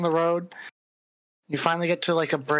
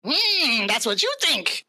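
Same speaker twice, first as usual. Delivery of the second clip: menacing goblin leader, pitched up; taunting goblin bandit; 7 semitones higher than usual. Acted. Game master voices goblin ambusher.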